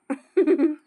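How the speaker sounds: short real chuckle; amused chuckle. Real, not acted. Amused at the child's tears. (ചെറിയൊരു ചിരി)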